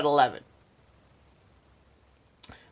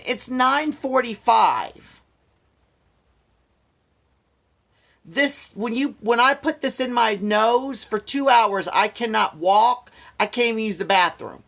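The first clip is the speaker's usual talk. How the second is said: exasperated; 6 semitones higher, 5 dB louder, slower than usual. Real, not acted. Annoyed at lateness.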